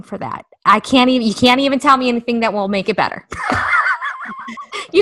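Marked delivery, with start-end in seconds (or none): 3.3-4.9 burst of laughter. Real, not acted. Guilt confession breaks into laughter.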